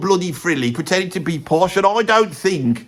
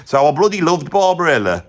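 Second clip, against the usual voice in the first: normal talking voice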